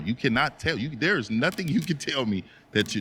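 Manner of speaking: ordinary delivery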